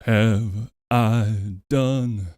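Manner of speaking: Doctor Light voice. Acted. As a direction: sing it as the sorrowful inventor. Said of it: "the Doctor Light voice".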